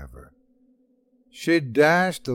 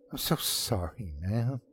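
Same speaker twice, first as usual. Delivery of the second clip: sheepish apology; 3 semitones lower, 9 dB quieter than usual. Acted. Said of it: "I apologized, feeling genuinely stupid".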